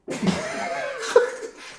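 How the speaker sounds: big, high burst of laughter. Real, not acted. Laughing at the crowd's reaction.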